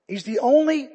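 usual speaking voice

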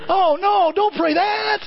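very high, comic panicked warning; mock alarm. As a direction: cry out a warning in pretend horror. Comic warning against such a prayer.

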